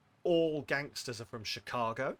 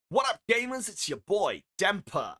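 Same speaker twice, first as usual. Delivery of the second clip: loud, high-pitched hype-streamer shout; hype streamer intro; 8 semitones higher, 9 dB louder than usual. Acted. Streamer performing Demper's quote story, loud hype intro. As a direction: blast out an over-the-top channel intro.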